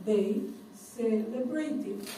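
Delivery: "They... celebrated", muy despacio y separado; demostración muy lenta. Shown as a demonstration. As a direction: alarga "celebrated" como modelo para alumnos. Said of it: "¿Se fijan?". ("They celebrated" pronunciado lentamente, palabra por palabra)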